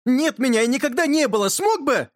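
high, impatient outcry; insistent yell. A narrator's reading. Yurka demanding an answer from his friend, insistent yell. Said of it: "закричал Юрка".

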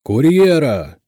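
loud, high bellow; terrible thundering voice. A narrator's reading. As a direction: roar a summons through the door. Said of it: "грянул страшный голос".

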